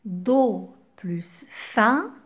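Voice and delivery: hushed sounding out; whispered syllable spelling. Shown as a demonstration. Teacher to pupils, whispering the syllables to blend. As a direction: whisper the sounds Do plus fin. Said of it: "teacher sounding out syllables".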